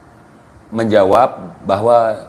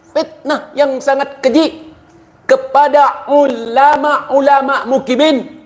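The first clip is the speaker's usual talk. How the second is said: outraged preacher. Pretend. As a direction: ham up a furious cleric defending his own. Mock outrage defending the cleric.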